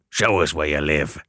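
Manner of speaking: low, rough convict voice; gruff convict. Acted. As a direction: growl the order. Convict demands.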